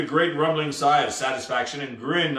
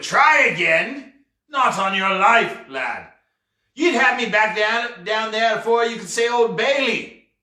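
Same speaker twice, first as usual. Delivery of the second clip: loud, high-pitched indignant outburst; exploding pirate; 7 semitones higher, 4 dB louder than usual. A narrator's reading. Pirate rejecting the boy's offer of a retry, exploding. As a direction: blow up at the very idea. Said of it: "Teach exploded".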